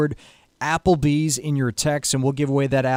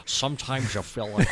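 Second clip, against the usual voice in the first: Sean Connery impression; 2 semitones lower, 5 dB quieter than usual. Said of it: "That was more Sean Connery".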